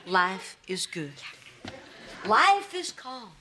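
slow, drawn-out words; deliberate self-soothing affirmation; relaxation mantra. Acted. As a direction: chant it slowly to calm yourself. Repeating relaxation mantras in bath.

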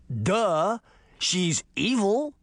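high, slow, sneering schoolboy taunt; mocking spider boy. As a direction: drag out evil like a gleeful bully. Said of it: "Toby said".

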